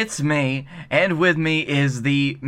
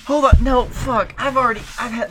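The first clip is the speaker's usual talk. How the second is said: startled outburst; 8 semitones higher than usual. Real, not acted. Sudden dismay at forgotten tasks.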